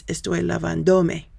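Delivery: lavándome stressed on "van"; model Spanish pronunciation. Shown as a demonstration. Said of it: "If you forget this one, it's".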